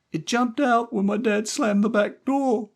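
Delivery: put-on high child voice explaining; young boy. A narrator's reading. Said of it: "Stevie explained".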